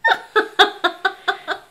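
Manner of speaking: high, loud laugh; real cracking up; burst of laughter. Real, not acted. Cracks up at costumed child.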